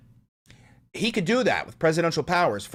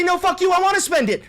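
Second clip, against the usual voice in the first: much higher, louder "No, fuck you"; mock angry retort; 13 semitones higher, 6 dB louder than usual. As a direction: snap back in a mock shouting match. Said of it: "to say".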